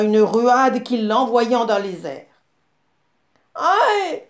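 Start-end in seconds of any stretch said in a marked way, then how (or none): 3.5-4.2 cri aigu et fort; hurlement de douleur. A narrator's reading. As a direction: crie en tombant sur le rocher brûlant. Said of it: "hurla le babouin". (le babouin hurle de douleur)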